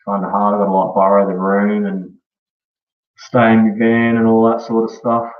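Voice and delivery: ordinary talk, nothing marked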